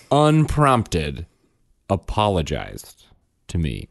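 usual speaking voice

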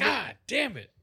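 high, loud put-on voice; hammed-up frustrated groan of a line; mock exasperated curse. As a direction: spit it out in a cartoonish huff. Voicing frustrated kid/parent.

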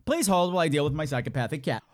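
ordinary delivery

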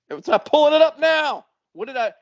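high, loud exasperated complaint; flustered outburst. Real, not acted. Flustered as the texts fail to load.

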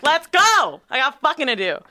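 loud high burst of impatience; eager. Retold or imitated. On the beach, itching to get home, loud excited cry. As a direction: shout it like you can't wait to leave.

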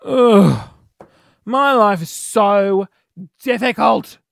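slow, drawn-out self-pitying moan; whinging. Pretend. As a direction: complain theatrically about your lot. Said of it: "whinging and complaining about my life".

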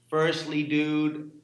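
hammy attempt at an American accent; poor American accent. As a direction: try an American dude voice, not very well. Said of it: "this is my poor American accent".

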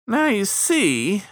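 high, drawn-out riverman voice; folksy know-it-all boatman. Acted. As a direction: lecture slowly in a high voice. Killdeer boatman lectures his shipmates.

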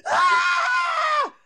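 very high, drawn-out fan scream; re-enacted scream. Starstruck girl at comedy camp hearing her favorite cartoon, mock screaming. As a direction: imitate an ecstatic scream without full volume. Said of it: "I start screaming at the top of my lungs".

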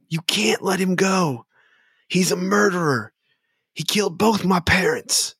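high, accusing, impassioned plea; Florin. Acted. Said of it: "Florin says".

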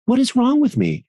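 high, anguished mock plea to himself; anxious self-questioning. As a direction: cry it out to yourself in alarm. His inner panic, asked again.

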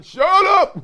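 high, loud bark from the coach; coach yelling. Retold or imitated. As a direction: snap at the room to shut up. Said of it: "Coach be yelling".

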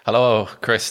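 ordinary delivery

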